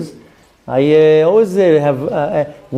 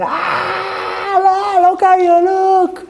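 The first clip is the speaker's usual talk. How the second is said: much higher shrieking shout, slow and teasing; mocking kids' shriek; much slower than usual. Kids laughing at their father's video, high teasing shriek. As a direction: shriek it like a kid laughing at dad.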